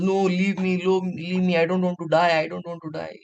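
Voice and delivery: high-pitched, breathless run of begging; fearful pleading. Acted. Caught cub begging the lion, acted panicky pleading. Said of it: "he's so fearful".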